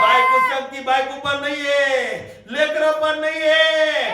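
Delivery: shouted Bible retelling; preaching at full force. Real, not acted. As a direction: drive the Jonah story home.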